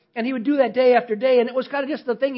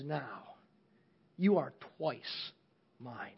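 softer and lower, tender and hushed; a boy quietly tells his boat it is his, softer and lower; 8 semitones lower, 14 dB quieter, slower than usual. Acted. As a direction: cradle the words like something precious. Boy holds his redeemed boat.

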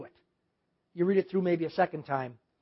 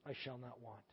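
very soft and low; paused, meditative reading; 7 semitones lower, 25 dB quieter than usual. Shown as a demonstration. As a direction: let the clause drop into a hush.

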